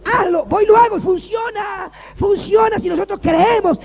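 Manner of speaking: very loud, high, slow preacher's shout; shouted preaching. Acted. Pastor shouting faith to the congregation, very loud and high. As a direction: cry it out to the whole church.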